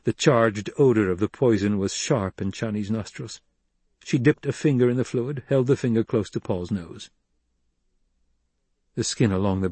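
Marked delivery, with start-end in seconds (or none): none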